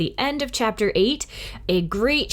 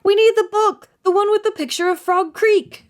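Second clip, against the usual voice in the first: put-on higher, louder panicked boy; Jack urgent; 13 semitones higher, 6 dB louder than usual. A narrator's reading. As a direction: rush it in alarm. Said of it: "said Jack".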